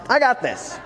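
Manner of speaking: high, bright insistence on I got this; forced confidence. Retold or imitated. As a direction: squeak out I got this, overselling it. Repeated self-reassurance, first take.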